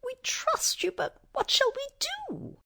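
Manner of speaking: high-pitched little mouse; squeaky mouse queen. A narrator's reading. Mouse queen asks how to help, high squeaky voice. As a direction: ask in a tiny royal squeak. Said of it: "said the queen".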